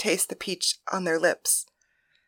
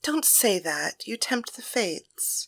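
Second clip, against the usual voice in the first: hushed; 8 dB quieter, slower than usual. Acted. Thalia hushing lover in bed.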